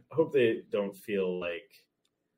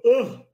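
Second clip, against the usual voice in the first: yawn; 13 dB louder than usual. Real, not acted. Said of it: "edit out my yawn".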